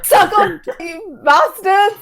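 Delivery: high, slow gloating jeer; taunting cry. Acted. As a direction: gloat over the rivals. Character taunting rivals.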